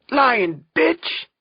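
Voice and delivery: high, loud accusing scream; outraged heckler's shout; furious yell. A narrator's reading. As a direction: yell the insult across the room. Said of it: "another yells".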